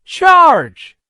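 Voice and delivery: high, loud knight's charge cry; rally your horse into battle. A narrator's reading. George charges at the dragon, a loud battle cry. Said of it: "cried George".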